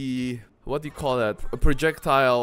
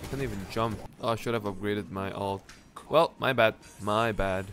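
normal talking voice

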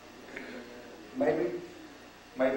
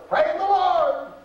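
put-on much higher, slow, drawn-out old woman's praise; high, slow, heartfelt "Praise the Lord" from the sick woman; invalid woman praising; 7 dB louder, much slower than usual. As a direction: let it rise high and slow like a bedridden saint. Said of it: "She said better than you said".

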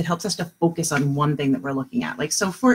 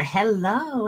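ordinary talk

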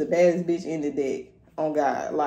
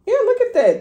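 much higher and louder, thrilled; excited; 10 dB louder than usual. Real, not acted. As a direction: squeal at the card. Empress card appears again.

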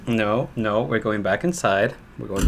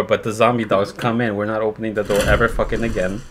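ordinary talk

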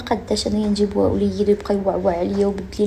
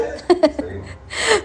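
laugh; 8 semitones higher than usual. Real, not acted. Laughs about Salima's men.

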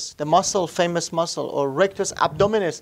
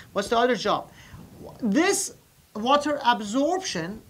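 usual speaking voice

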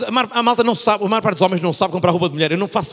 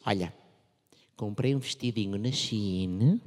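soft, slow, low and coy; sweet girlfriend voice; 9 semitones lower, 9 dB quieter, slower than usual. Retold or imitated. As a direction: say it coyly, drawing it out.

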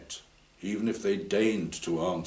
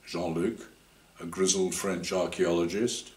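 ordinary delivery, nothing marked

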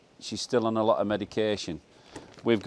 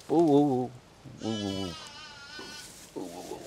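soothing crooned oohs; 5 dB quieter than usual. Real, not acted. Farmer settles a skittish foal, soothing oohs fading away.

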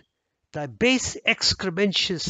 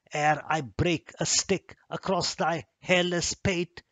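usual speaking voice

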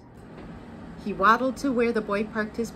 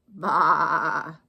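low, quavering sheep baa; sheep bleat; 5 semitones lower, 5 dB louder than usual. A narrator's reading. Sheep answering Duck as he rides by, sheep bleat. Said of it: "said Sheep".

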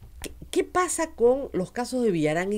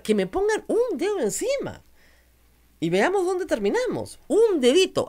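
retold taunt in high, swooping pitch; defiant dare; 7 semitones higher than usual. Woman retelling how she faced down hecklers, defiant dare. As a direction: challenge them, swinging the voice up.

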